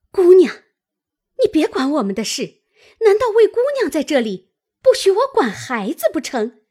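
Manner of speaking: fast, high-pitched snapping; indignant rebuff. A narrator's reading. Old woman tells girl not to interfere, high and indignant. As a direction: cut her off sharply, fast and high.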